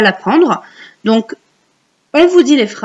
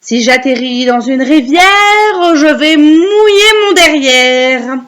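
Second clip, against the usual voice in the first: slow, swelling singsong recital; recite for repeating; 5 semitones higher, 5 dB louder, slower than usual. Shown as a demonstration. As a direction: draw the verse out loud and long. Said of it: "vous allez réciter après moi".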